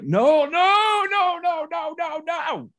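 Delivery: very high, loud wailed refusals; imitated referee yelling. Referee cuts off coach's explanation, imitated yelling. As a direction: cut in with a long high holler, fading in force. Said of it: "at the top of his lungs for everybody to hear".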